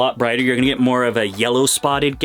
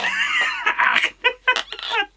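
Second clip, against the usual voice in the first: screaming high laugh; shrieking laugh. Real, not acted. Gecko struggle.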